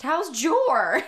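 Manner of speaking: high, loud teasing "How's Jor?"; jokey mock question. Friend texting about misnamed dog, high jokey question. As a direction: voice the running joke with relish.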